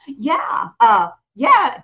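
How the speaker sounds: a sudden loud, playful "Yeah"; loud mock yeah. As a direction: shout the yeah back cheekily. Mock reply to angry commenter.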